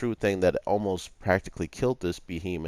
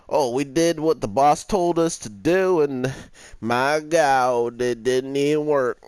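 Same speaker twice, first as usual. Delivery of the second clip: mocking dim soldier; 5 semitones higher, 4 dB louder than usual.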